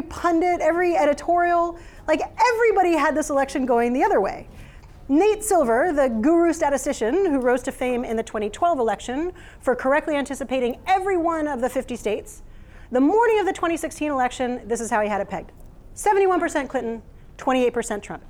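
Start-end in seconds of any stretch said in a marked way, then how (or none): none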